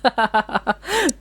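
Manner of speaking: a high, disbelieving burst of laughter; incredulous laugh. Real, not acted. Streamer laughing at the debate topic he was dealt. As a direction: laugh at how absurd the prompt is. Reacting to absurd debate prompt.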